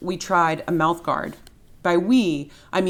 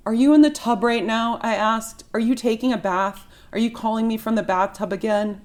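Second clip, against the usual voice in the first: exasperated; 7 semitones higher, 4 dB louder than usual. Acted. Exasperated narrator confronts father.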